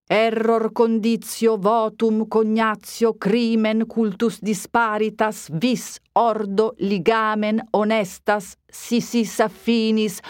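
slow, deliberate Latin recital; slow pedantic recitation of Latin terms; rattled-off Latin. Acted. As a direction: spell out the list like a pedantic priest. Priest reels off Latin impediments.

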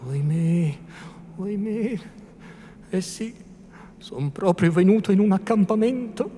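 high-pitched private lament; muttering to himself. A narrator's reading. Priest lamenting inwardly at the armed camp, high-pitched self-talk. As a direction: wail to yourself in dismay, keeping it small. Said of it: "dicendo intanto tra sé".

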